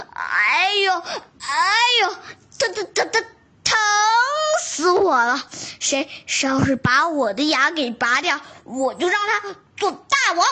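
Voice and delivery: mock howling ouch ouch; groaning in pain. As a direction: wail about the toothache. Tiger howls with toothache.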